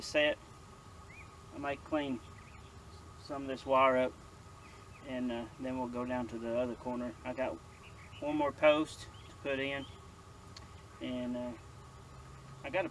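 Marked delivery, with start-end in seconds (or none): none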